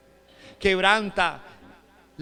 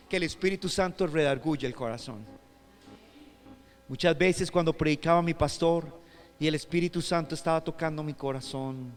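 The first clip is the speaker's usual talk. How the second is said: ordinary delivery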